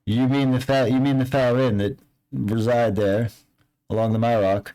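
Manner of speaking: hint at dark secrets, fishing for payment; ominous local NPC. Acted. NPC lore-telling ominously.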